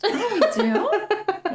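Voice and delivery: high, slow, laughing fake astonishment; drawn-out, high, playful "oh, we do?"; mock surprise. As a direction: act amazed you even have a podcast, giggling. Mock surprise at own podcast.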